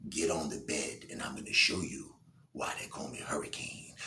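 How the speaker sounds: low breathy whispered come-on; seductive whisper. Acted. Escort whispering to her client to get on the bed. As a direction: whisper it like a promise.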